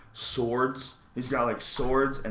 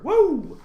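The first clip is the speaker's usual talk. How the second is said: loud, high-pitched "woo"; awed whoop; 11 dB louder than usual. Real, not acted. Awed by Cain Velasquez, an excited whoop. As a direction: let out a big high whoop.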